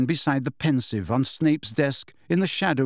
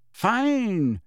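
cool, higher-pitched dismissal; 8 semitones higher than usual. A narrator's reading. Lily to Snape after his insult, cool. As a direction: say it coolly, as if done with them. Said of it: "she said coolly".

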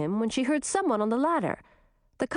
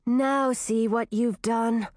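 slow, cold reproach; glaring reproach; slower than usual. Acted. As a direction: scold her slowly and coldly. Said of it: "Gracina glared at Chartreuse".

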